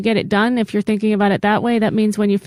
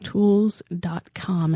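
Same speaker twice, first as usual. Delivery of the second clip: slow deliberate; much slower than usual. Real, not acted. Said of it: "slow spelled-out web address".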